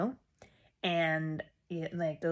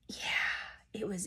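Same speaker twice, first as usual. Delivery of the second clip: whispered; 2 semitones lower, 10 dB quieter, much slower than usual. Real, not acted.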